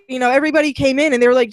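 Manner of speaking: ordinary delivery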